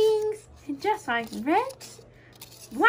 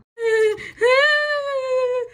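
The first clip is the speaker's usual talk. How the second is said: high shrieking laugh, louder; 8 semitones higher, 5 dB louder than usual. Acted. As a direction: burst into a squealing cackle. Toy character bursting out.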